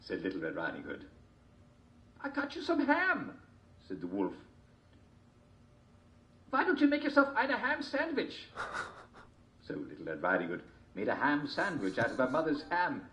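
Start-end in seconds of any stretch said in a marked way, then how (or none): 2.2-3.4 high wolf voice. A narrator's reading. 6.5-8.5 high wolf voice. A narrator's reading.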